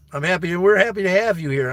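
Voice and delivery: choked-up joy aimed at his friend; emotional burst. Real, not acted. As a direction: let the happiness spill over as your voice lifts. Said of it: "I get a little emotional".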